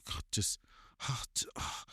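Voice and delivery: whispered, exasperated, trailing off. Retold or imitated. Mimicking his wife's frustrated sigh over the lost chicken, whispered. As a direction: breathe it out in frustration. Wife quoted exasperated, breathed.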